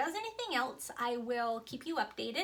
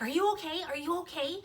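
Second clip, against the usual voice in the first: imitating mom's anxious check, higher pitched; panicked; 5 semitones higher, faster than usual. Mom who can't hear her child on the phone, panicked. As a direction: repeat it urgently, as a retold panic. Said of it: "she sounds really, really panicked".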